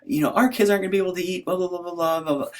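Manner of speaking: high, rushed, hammed-up scolding; high-pitched frantic mock rant; mock yelling wife. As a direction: imitate a wife's tirade, starting loud, trailing into blah-blahs. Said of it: "So she's yelling at me".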